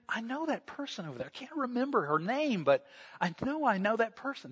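quiet, fast aside to a friend; hushed aside. Acted. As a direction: lean in and murmur about someone across the room. Telling friend about stranger.

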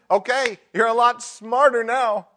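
loud high mocking preacher voice; hammed-up condescending preacher. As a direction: sneer it like a smug preacher. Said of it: "is the end of the sermon".

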